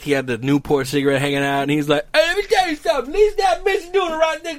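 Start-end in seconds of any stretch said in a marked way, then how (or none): 2.1-4.6 much higher character voice, worked up; older man impression. Imitating an older man waiting for the bus, indignant impression. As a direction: play an older guy ranting, missing his teeth.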